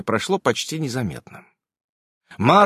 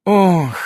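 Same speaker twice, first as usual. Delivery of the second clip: long, weary "oh" sigh; heavy sigh; 4 semitones higher, 5 dB louder than usual. A narrator's reading. Irene takes the bowl away, sighing heavily. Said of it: "тяжело вздохнула Ирен".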